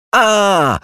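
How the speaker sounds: high-pitched storybook shriek; sudden scream. A narrator's reading. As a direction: cry out suddenly, far up in pitch. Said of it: "wrzasnęła nagle Róża".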